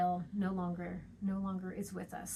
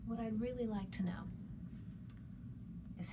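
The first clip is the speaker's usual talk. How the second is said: soft unvoiced wondering; whispered aside; 9 dB quieter, faster than usual. Shown as a demonstration. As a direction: lean in and whisper it like a secret. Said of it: "as I'm leaning back and forth".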